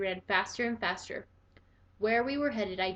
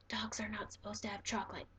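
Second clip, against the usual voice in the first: soft, unvoiced whisper; whispered thought; 10 dB quieter than usual. A narrator's reading. Harold mourns his lost treats in a whisper.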